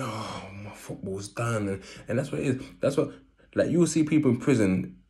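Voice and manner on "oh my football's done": soft, slow regretful murmur; a quiet slow lament; quiet regretful thought. Retold or imitated. Retold thought in prison.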